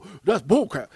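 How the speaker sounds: exaggerated scoffing protest; people scoffing. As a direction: blurt it out loud as the dismissive crowd. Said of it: "And people".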